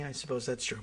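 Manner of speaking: ordinary talk, nothing marked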